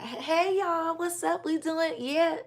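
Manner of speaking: high, loud, peppy vlogger hello; a fake-cheery YouTube opener; bubbly hype greeting. Shown as a demonstration. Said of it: "today ain't that type of day for me".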